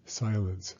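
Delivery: ordinary delivery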